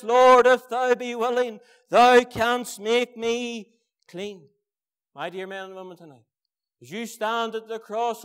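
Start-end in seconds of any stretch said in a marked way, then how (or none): 0.0-4.5 loud, slow, earnest plea to the Lord; pleading leper. Acted. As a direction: cry it out slowly, begging to be made clean. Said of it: "And he came and he says".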